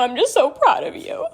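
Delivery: tearful, voice lifting as it chokes up; getting emotional. Real, not acted. Daughter repeating her mom's pride, choked up. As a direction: hold back real tears and apologise. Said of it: "I'm getting emotional".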